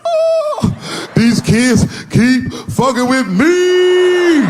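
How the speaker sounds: slow, high wailing mock cry, shouted. Son crying about the kids, hammed-up wail. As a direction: bawl out the complaint dramatically. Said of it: "started crying like he was [name]".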